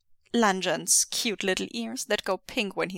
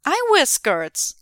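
obvious; 7 semitones higher, 7 dB louder, slower than usual. Acted. Obvious duh tone.

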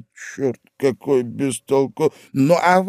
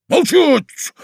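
sharp command; 11 semitones higher, 3 dB louder than usual. Acted.

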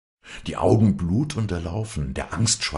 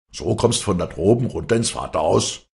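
louder, raised voice of a scolding father; angry shouted reproach; 5 semitones higher, 7 dB louder than usual. A narrator's reading. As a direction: demand it indignantly of your daughter. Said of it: "schrie Stromminger".

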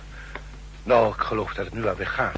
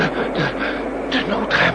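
faked gasp; 9 semitones higher, 4 dB louder, much slower than usual. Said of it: "via de noodrem en een gesimuleerde".

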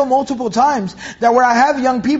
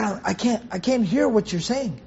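put-on soft, halting old man's reply; dying old man; 8 dB quieter than usual. Dying uncle who can't hear his nephew, weak reply. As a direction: answer feebly, much softer, stumbling at the start. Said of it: "an old man who's already dying".

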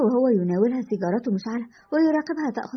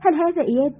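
higher, mildly delighted question; happy; 6 semitones higher, 3 dB louder than usual. A narrator's reading. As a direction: ask with a lift of happy surprise. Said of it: "غادة بسعادة". (بسعادة خفيفة)

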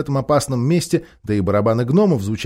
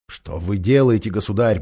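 ordinary delivery, nothing marked

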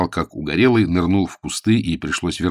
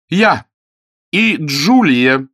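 loud high, stretched out; emphatic insistence; 9 semitones higher, 7 dB louder, much slower than usual. A narrator's reading. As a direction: pound it home. Lady insisting.

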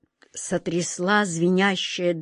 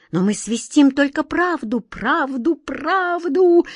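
each правду pitched higher, the last one high and strained; rising insistence; 6 semitones higher, 3 dB louder, slower than usual. A narrator's reading. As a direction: climb up on правду, правду. Whirlwind insists on truth.

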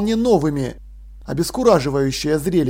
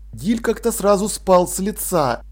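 plain reading voice

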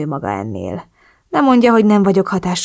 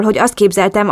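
usual speaking voice

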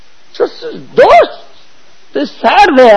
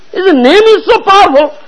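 ordinary talk, nothing marked

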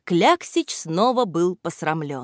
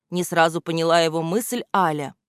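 ordinary delivery, nothing marked

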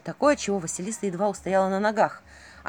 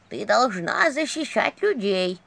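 put-on slow, instructive old teacher; lecturing; slower than usual. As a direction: lay down the lesson slowly. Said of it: "поучала Ягиня".

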